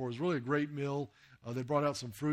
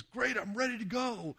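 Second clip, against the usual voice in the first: eager castaway; 9 semitones higher, slower than usual. Acted. Rescued island survivor eager to leave.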